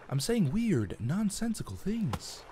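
halting dramatic pauses, rising pitch; William Shatner impression. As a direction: do a bad Shatner, drawn out. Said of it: "the best Shatner impression ever".

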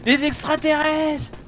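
high-pitched, drawn-out alarm cry; hammed-up warning of an alien invasion; mock panic. Fausse panique devant les extraterrestres. (panique surjouée)

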